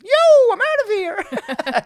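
high, drawn-out "I'm out of here"; mock lady bailing. Woman bolting from drug dealers, high and slow. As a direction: squeal it out as she flees. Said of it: "she fucking just".

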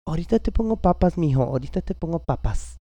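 high, fast, loud motherly Spanish; stepmom voice. Retold or imitated. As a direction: fuss over a sick kid. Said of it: "my stepmom's like".

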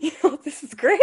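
high, loud, laughing through it; giggling while quoting herself as a kid. Retold or imitated. Retold childish delight, laughing.

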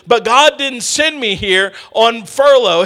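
ordinary talk, nothing marked

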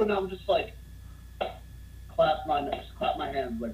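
ordinary delivery

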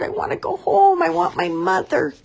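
very high, pleading chick voice; a lost chick close to tears; homesick baby bird. Acted. Baby bird wants home.

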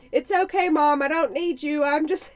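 put-on sing-song like an eager little boy; kid's sweet call. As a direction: son reassuring mom from the hallway. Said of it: "he's like".

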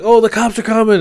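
high alarmed cry, cops coming; panicked warning. Retold or imitated. Retold panicked crowd.

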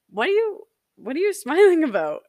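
partner's puzzled question. Retold or imitated. Partner asking why she is grinning, puzzled and high.

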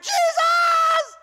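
full-throated high scream of Jesus. Acted. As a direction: yell it in sudden terror. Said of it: "and I screamed".

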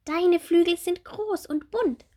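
high, bright little bumblebee; small bumblebee voice. Acted. Little bumblebee Bommel asking Marie about her wings, high childlike voice.

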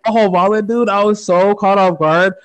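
usual speaking voice

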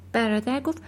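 plain reading voice

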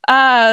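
loud, high "ah" of alarm; read-aloud yelp of dismay; alarmed cry. Acted. Character cries out in alarm.

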